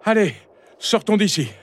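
high-pitched call to get going; rally your father in a high young voice; high, urging son's voice; eager youngster calling out. A narrator's reading. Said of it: "il se tourna vers son père et lança".

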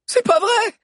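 very high, loud cry of disbelief; high-pitched horrified wail; distraught cry. A narrator's reading. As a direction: scream it as if about to burst into tears. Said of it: "Alix éclata en sanglots".

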